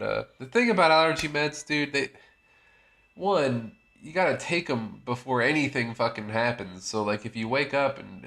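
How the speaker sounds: ordinary talk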